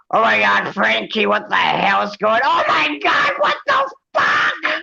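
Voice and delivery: high panicked screeching mother; shrieking mom. Retold or imitated. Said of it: "his fucking mother comes like".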